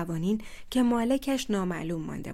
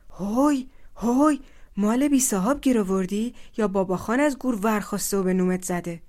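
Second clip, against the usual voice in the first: shouting; 7 dB louder than usual. Acted. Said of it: "داد زد".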